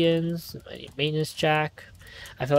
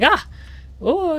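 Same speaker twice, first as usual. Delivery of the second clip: short high yelp, then a high wail; acted-out shriek of fright; mock scream; 4 dB louder than usual. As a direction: imitate your own jump-scare scream. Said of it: "sometimes I'll scream if I feel".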